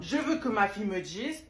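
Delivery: normal talking voice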